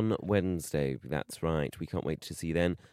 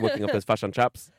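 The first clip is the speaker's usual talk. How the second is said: put-on louder, "fish and chips" in a jokey New Zealand accent; mock New Zealand accent; 5 dB louder than usual. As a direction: tease her with a put-on New Zealand twang. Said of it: "New Zealand accent".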